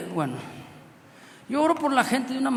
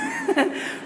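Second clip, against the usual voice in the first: short high laugh breaking in; a burst of giggle; preacher laughing while recounting; quick laugh. Real, not acted. Laughs mid-sentence.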